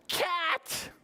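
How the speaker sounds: high startled cat!; alarmed cry. Acted. Story alarm.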